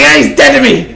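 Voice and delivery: hammed-up angry declaration; angry fan quote. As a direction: play the fan who's so done with Beltran. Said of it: "He's so angry".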